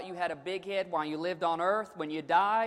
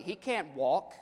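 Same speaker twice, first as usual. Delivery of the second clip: ordinary talk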